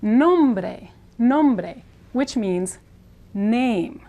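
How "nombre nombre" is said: "Nombre" as nohm-breh with tapped r, first syllable stressed; Spanish pronunciation. Shown as a demonstration. Teacher introduces the word for name, careful Spanish pronunciation. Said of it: "So this word is pronounced".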